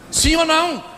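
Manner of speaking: usual speaking voice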